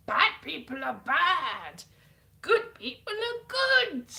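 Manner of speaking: "Bad people are bad. Good people are good" loud and drawn out; slow emphatic slogan. Spelling out the show's agenda.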